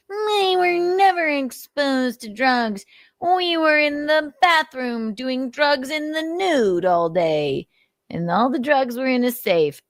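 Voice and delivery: high, slow, sneering mimicry of the denial; mocking impression. Host mocks streamer's claim to viewers, high sneering mimic. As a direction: parody him as a smug liar, drawn out.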